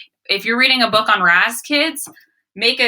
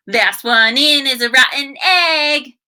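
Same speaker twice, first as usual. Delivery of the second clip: a hammed-up kid's taunt, slow and loud; mock yell; 6 semitones higher, 4 dB louder, slower than usual. Wally bursting in, shouting a slow playful taunt. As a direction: tease like a boisterous boy. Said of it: "he yelled".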